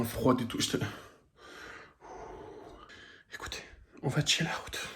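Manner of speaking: soft unvoiced whisper. Real, not acted. Signing off to viewers for the night, in a soft whisper. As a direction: whisper it to calm down.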